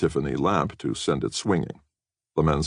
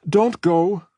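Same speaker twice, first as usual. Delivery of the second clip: put-on much higher, louder pleading girl; 6 dB louder than usual. A narrator's reading. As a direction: Katie begging her friends to stay. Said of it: "she was pleading".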